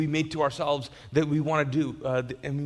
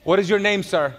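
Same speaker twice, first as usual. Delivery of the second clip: loud, higher nurse calling him; firm, raised hospital voice; 5 semitones higher, 7 dB louder than usual. Retold or imitated. Nurse calling out to the fainted patient, loud and higher. Nurse asking his name.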